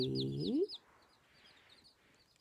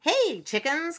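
high, loud storybook call; 12 semitones higher, 8 dB louder, slower than usual. A narrator's reading. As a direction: call over to the little chickens. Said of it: "called the dogs".

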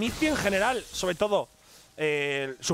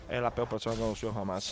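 low, quiet and quick; calm, friendly tone; 9 semitones lower, 6 dB quieter than usual. Acted. Caster imitates a player's amiable insult, calm low tone. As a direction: deliver an insult casually, low and soft. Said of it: "Es como un tono calmado".

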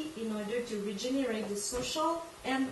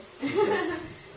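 laugh; 7 semitones higher, 5 dB louder than usual. Real, not acted. Laughs after joke.

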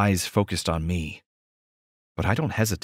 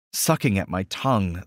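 usual speaking voice

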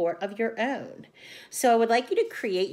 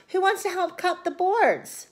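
asking brightly; 9 semitones higher than usual. A narrator's reading. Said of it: "Ruby asked".

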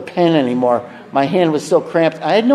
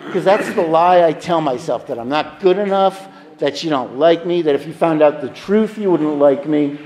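ordinary talk, nothing marked

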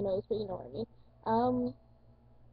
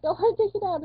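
higher, louder panicky blurt; panicked exclaim; 8 semitones higher, 5 dB louder than usual. Retold or imitated. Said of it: "I'm like".

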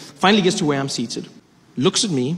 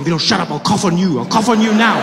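louder, playful menace; mock threat; 4 semitones higher, 6 dB louder than usual. [name] threatening to cough on the passenger, playful loud threat. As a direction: threaten him half joking. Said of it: "I was like".

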